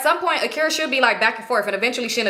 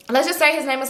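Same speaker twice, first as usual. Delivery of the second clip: usual speaking voice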